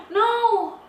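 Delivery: high shocked cry; dismayed. Real, not acted. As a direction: wail it in dismay. Video accidentally deleted.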